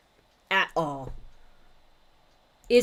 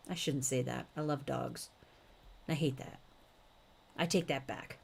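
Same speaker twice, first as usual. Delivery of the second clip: sheepish aside; 5 semitones lower, 13 dB quieter, faster than usual. Real, not acted. Sheepish aside about dadism.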